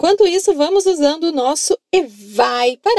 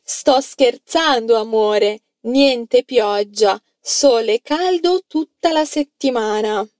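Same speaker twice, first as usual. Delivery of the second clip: slow model sentence; 3 semitones lower than usual. Shown as a demonstration. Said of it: "fala pra mim em italiano".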